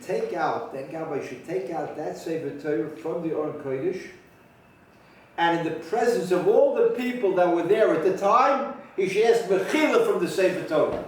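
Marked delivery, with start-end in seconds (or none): 5.4-11.0 louder, weighty emphasis on the ruling; emphatic statement. Real, not acted. Teacher reveals the ruling's important conclusion to listeners, emphatic. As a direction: drive home "ask Mechila from the Sefer Torah" with force. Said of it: "Pause for emphasis".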